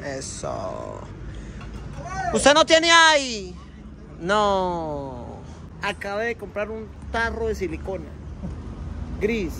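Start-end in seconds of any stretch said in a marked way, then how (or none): none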